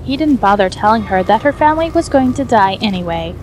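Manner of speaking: ordinary delivery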